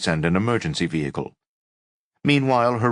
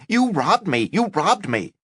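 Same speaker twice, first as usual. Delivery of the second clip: shouting; 12 semitones higher than usual. Acted. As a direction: yell it as she fights. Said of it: "Now she was shouting".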